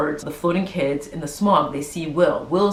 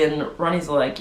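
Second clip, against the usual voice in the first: ordinary delivery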